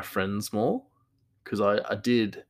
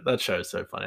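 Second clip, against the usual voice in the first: ordinary talk, nothing marked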